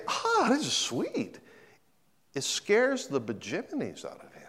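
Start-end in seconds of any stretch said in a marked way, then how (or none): 0.1-1.4 mock contentment. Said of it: "he doesn't say".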